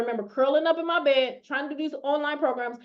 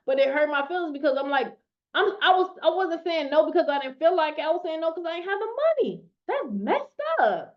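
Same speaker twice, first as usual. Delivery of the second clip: normal talking voice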